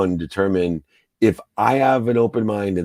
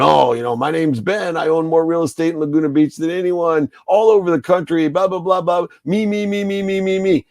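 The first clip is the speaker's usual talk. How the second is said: higher-pitched, hammed-up bragging voice; mock braggart; 7 semitones higher than usual. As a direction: mimic a self-absorbed show-off, pitched up. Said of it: "not bragging, going".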